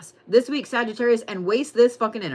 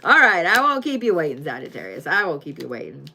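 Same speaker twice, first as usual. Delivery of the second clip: normal talking voice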